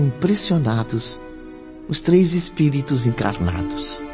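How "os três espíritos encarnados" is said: ordinary talk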